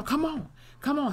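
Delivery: high-pitched imitation of dramatic pleading; mock urging. Montana urging wounded Trevor to keep walking, mock-dramatic. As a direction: coax them along, hammed-up.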